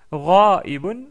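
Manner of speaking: "Ra'ibun" said loud, with the syllables clearly split; Arabic word. Shown as a demonstration. Teacher isolating the Arabic word for the class. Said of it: "ra'ibun veut dire".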